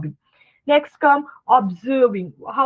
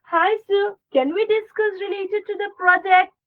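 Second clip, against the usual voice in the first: raised friendly ask; bright phone opener; 7 semitones higher than usual. Shown as a demonstration. Asking a client on the phone, politely bright. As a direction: lift the pitch and ask politely.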